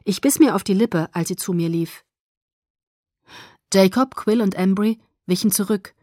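ordinary delivery, nothing marked

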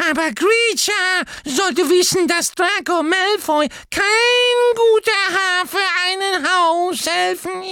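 very high, loud defiant squeak; Dobby scolding Kreacher; defiant elf. A narrator's reading. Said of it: "wandte sich trotzig".

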